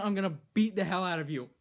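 ordinary delivery

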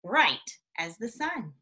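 slow, lilting storybook reading; reading to children. A narrator's reading. Reading a rabbit story aloud to young children, gently lilting. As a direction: read it like bedtime story time. Reads picture book aloud.

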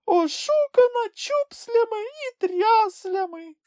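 very high, slow little wail; high creature lament, close to a sob; tearful lament. A narrator's reading. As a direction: wail it slowly in a squeaky voice. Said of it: "Він схлипнув і замовк".

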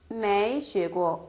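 each syllable spelled out slowly; slow model reading. Shown as a demonstration. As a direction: read it slowly for learners. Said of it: "배운 적이 없다?". (천천히 또박또박 읽는 예문)

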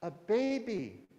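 high, slow delighted gasp of wonder; excited cry. Acted. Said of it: "Daffodil cried".

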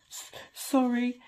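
slow, broken sobbing apology. Acted. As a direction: choke the word out through tears. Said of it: "sobbed Hagrid".

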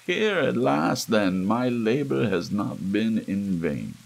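triumphant gloating. A narrator's reading. Murderer gloating to himself after walling up the body, triumphant. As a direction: savor the victory over the hidden corpse. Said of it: "I looked around triumphantly".

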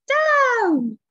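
high, loud, drawn-out falling shout; witch's cry. A narrator's reading. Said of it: "cried the witch".

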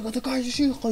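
mock angry father. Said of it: "Y de repente".

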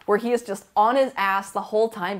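ordinary talk

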